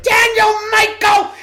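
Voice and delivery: very high, slow mock mom shout; an exasperated mom calling her son back; mother yelling.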